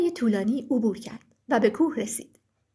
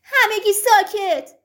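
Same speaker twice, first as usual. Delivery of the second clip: high, loud, drawn-out yell for silence; furious shout; 5 semitones higher, 7 dB louder, slower than usual. Acted. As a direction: lose patience and bellow slowly. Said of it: "با عصبانیت داد زد". (فریاد خشمگین و کشیده)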